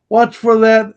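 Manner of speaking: sudden loud call down the stairs; warning call. Retold or imitated. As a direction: shout a quick warning. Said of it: "looks at me and goes".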